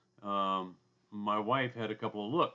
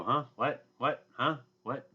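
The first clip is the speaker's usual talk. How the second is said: hard of hearing; 4 semitones higher, 4 dB louder than usual. Retold or imitated. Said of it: "I go".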